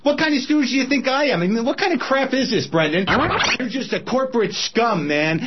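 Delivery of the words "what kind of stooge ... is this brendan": raised, heated challenge to the executive; an angry host turns on his boss; angry retort. Acted. Host rounds on executive, higher and heated.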